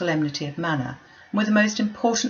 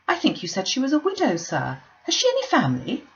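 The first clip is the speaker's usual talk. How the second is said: fussy mother, higher and fluttery; lightly voiced gushing matron; eager, chattering mother; Mrs Bennet; 6 semitones higher than usual.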